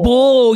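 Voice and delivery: loud, drawled "Bull"; Southern pronunciation. Shown as a demonstration. Southerner correcting her learner, loud drawled "Bull". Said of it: "the most Southern".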